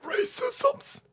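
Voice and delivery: high villain cackle into a word; evil cackle. Acted.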